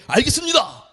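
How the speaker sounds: high, crisp private reporting to a senior; military yes-sir voice; private answering a senior. Retold or imitated. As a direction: snap it out like an eager recruit. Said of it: "쫄병은 고참에게".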